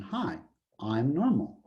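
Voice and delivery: soft, lightly voiced cartoon greeting; a hint of a cartoon character, softly; SpongeBob. Distribution introduces itself to the class, like SpongeBob. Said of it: "just like SpongeBob".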